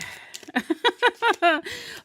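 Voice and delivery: high rippling laugh; real laugh. Real, not acted. Intuitive reader laughing at her own life, high laugh.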